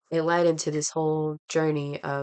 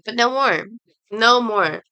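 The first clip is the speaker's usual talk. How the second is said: higher, louder defiant declaration; firm resolve; 5 semitones higher, 8 dB louder than usual. Retold or imitated. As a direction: slam the door on the shame. Woman firmly ends her shame.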